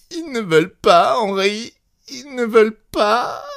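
high, tearful, voice breaking into sobs; a spoiled child crying to her husband; sobbing. A narrator's reading. Wife sobs to her husband over the refusal. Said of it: "avec une voix pleine de sanglots".

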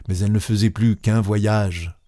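ordinary delivery, nothing marked

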